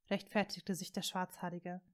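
plain reading voice

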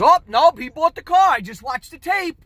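high, loud insistent retort. Retold or imitated. Manager insists over the phone.

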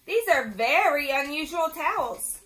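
loud, emphatic storybook call. A narrator's reading. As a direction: call out in puzzlement. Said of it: "he called".

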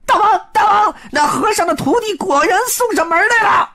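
very high shrill-voiced imp yelling; imp shouting. Little demon shouting into the cave to its king, very high voice. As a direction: squeal out the news to the king. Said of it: "立马冲着洞里喊".

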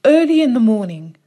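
plain reading voice

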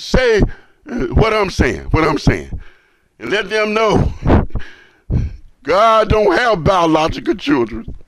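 loud, rising fiery sermon voice; preaching. Acted. Imam preaching to brothers and sisters, loud and fervent. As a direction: preach it out to the whole room.

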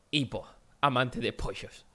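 a hint of a slow, mumbly Hipo. Announcing to his friend he'll play as Hipo, slow and soft. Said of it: "no voy a ser yo mismo. Voy a ser".

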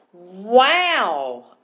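loud, drawn-out storybook wow; excited scream. Acted. Girl cutting off the mermini, excited scream. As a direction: burst in with a big amazed cry. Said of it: "I screamed excitedly".

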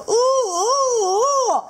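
high loud muffled oh-oh-oh; tilapia with mouth full. Acted. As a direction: hum through a mouthful of eggs. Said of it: "吴郭鱼爸爸就说".

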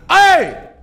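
loud high startled yell; sudden shout. Real, not acted. Streamer startled by something in the game, shouting. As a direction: shout out in alarm.

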